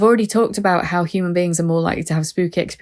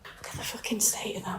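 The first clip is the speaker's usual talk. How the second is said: whisper; 3 semitones higher, 15 dB quieter than usual. Real, not acted. Horrified at a torture chair.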